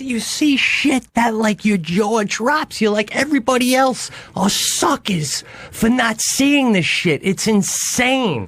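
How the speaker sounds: slow, high, awed description; awestruck. Real, not acted. Man tells friends about the views, slow and awed. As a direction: draw it out in wonder.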